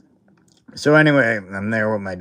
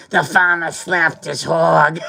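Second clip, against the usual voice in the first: put-on slow, loud, higher thick Boston accent; heavy Boston accent; 7 semitones higher, 4 dB louder, slower than usual. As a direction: boom it out like a Boston dad. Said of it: "in his heavy Boston accent".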